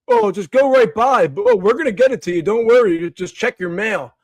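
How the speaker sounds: high, loud, breezy official voice; mock toll collector. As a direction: parody a toll company waving you through. Mocking the toll road's assurances.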